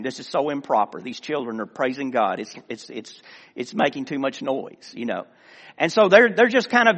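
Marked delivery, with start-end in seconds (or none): none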